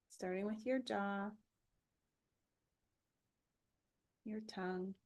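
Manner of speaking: calm body-scan guide with long pauses; even, unhurried relaxation voice; guided meditation. Acted.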